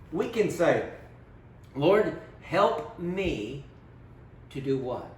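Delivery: ordinary delivery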